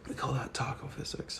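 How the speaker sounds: whispered aside. Real, not acted. Streamer whispering a joke to chat about taco physics, hushed. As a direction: murmur the joke under your breath.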